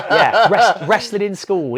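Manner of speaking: loud, high words through laughter; laughing. Real, not acted. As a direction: talk while cracking up at yourself. Laughing over his wrestling defeat story.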